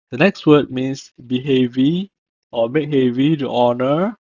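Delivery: normal talking voice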